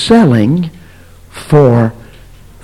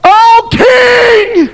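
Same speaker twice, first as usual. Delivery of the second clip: high shouted plea to the king; a woman's desperate wail; desperate cry. Acted. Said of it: "one of the ladies cried out".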